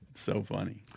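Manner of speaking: ordinary talk, nothing marked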